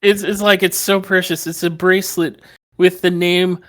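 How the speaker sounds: high, loud mocking whine; CM Punk. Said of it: "take a shot at Punk".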